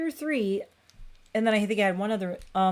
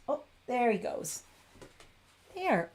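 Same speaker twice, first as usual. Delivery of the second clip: cooing; 5 semitones higher, 4 dB quieter than usual. Real, not acted. Cooing at cat.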